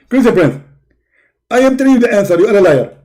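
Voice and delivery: high, loud, indignant preacher voice; Zakir Naik impression. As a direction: bark it like a self-righteous debater. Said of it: "My name is Zakir Naik".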